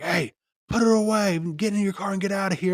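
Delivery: high, loud mock yell of a bike cop ordering him off; mock security guard yell. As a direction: squeal it like a bossy guard shooing you away. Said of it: "He's like".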